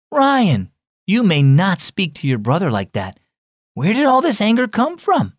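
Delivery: high, sharp name then firm reproof; lightly voiced mom telling off her son; scolding mother. A narrator's reading. Mom to Ryan after he shouted at his brother, scolding.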